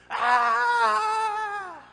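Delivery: a long, high, loud wail; tantrum scream. Retold or imitated. Son melting down in the toy aisle, a long, loud wail. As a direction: let out a toddler's meltdown scream. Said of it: "He just".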